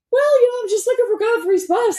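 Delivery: very high, airy and unbothered; breezy, lost in thought; carefree distracted voice. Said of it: "And I was like".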